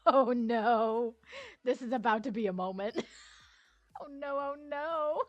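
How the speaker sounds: loud laughing Oh no cries; dreading the ducks being revealed, laughing; laughing mock panic. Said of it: "I was like".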